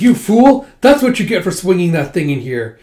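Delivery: loud, high opening, easing slightly; angry scolding. Acted. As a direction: scold a reckless fighter loudly. Acted scolding during fight.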